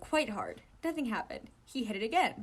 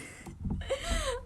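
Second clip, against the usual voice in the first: high-pitched giggle. Real, not acted. Reader laughs alone.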